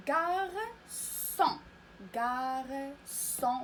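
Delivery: "غارسون" very slow, in two held syllables; model pronunciation. Shown as a demonstration. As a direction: stretch gar, then son with a clear s in "غارسون". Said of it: "غادي نقراوها غارسون". (نطق "غارسون" ببطء بمقطعين ممدودين)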